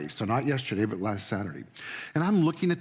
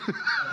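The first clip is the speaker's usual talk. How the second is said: a sudden high laugh; short high real laugh; high burst of laughter. Real, not acted. Laugh after the story.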